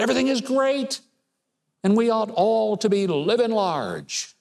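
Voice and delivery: mocking upbeat claim, pitched high; mock political cheerleading. Host parroting the president and his acolytes, high mocking cheer. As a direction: parrot the official line, high and emphatic. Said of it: "told by the current president and his acolytes".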